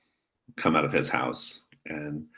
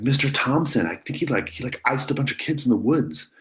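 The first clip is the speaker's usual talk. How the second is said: higher, breathless rumor-telling; eager gossip; 8 semitones higher than usual. Acted. Ben tells Jonah the rumor, eager and high. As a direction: spill the rumor with glee. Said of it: "he's like".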